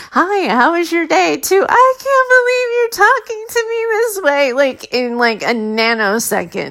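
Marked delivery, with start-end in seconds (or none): 0.1-1.3 high, sing-out everyday hello; mock casual greeting, pitched up; pleasant greeting before meltdown. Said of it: "it went from, like". 1.7-4.5 very high, exaggerated crying wail; hammed-up weepy protest, pitched way up; mock tearful outburst. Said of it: "instantly I'm crying".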